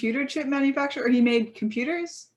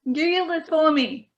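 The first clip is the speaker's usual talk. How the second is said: put-on higher, slow, broad Australian accent; a nagging Australian wife bossing her husband; mock naggy Australian wife, drawn out; 6 semitones higher than usual. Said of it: "took on an Australian accent".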